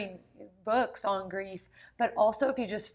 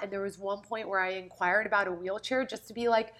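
ordinary delivery

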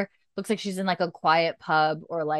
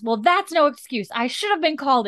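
higher, louder indignant snap at the nurse; 8 semitones higher, 3 dB louder than usual. Retold or imitated. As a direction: bite the nurse's head off, imitating the doctor. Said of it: "so pissed".

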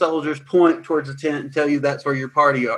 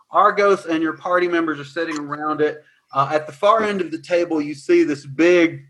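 normal talking voice

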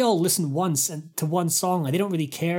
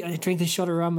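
ordinary talk, nothing marked

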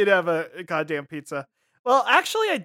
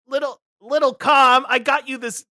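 higher, louder put-on character voice; baby mobster; 6 semitones higher, 6 dB louder than usual. Little mobster giving his sweetheart a gift, put-on voice. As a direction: offer a gift as a mock gangster.

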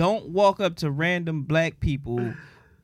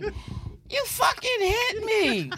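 much higher, slow, drawn-out accusation; dazed smoker lady; much slower than usual. Retold or imitated. Woman he ran over accusing him, high and slow. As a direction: drag it out, stunned.

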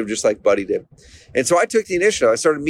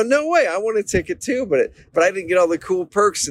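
much higher, eager "No way"; amazed disbelief; 7 semitones higher than usual. Retold or imitated. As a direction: sound thrilled and stunned at the news. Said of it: "And I go".

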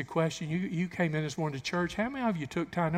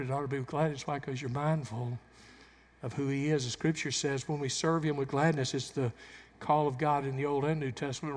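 ordinary talk, nothing marked

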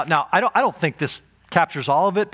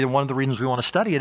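usual speaking voice

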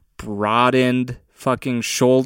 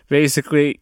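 ordinary delivery

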